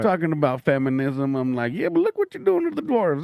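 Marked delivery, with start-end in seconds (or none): 1.7-3.2 high-pitched incredulous objection; indignant protest. Retold or imitated. Comic calling out the movie over the dwarves, indignant. Retelling own mock protest.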